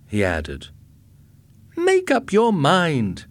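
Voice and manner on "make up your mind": slow, high, loud demand; exasperated. A narrator's reading. Wife fed up with husband's two answers, exasperated. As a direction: push each word out slowly, high and impatient.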